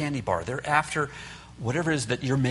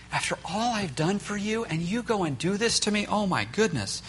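mock guilt trip; 7 semitones higher than usual. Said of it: "shame and guilt".

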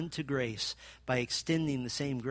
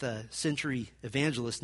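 ordinary talk

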